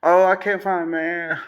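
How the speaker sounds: high, mocking whine of a heartbroken woman; mocking whiny woman. Mocks women complaining about men, high whiny voice.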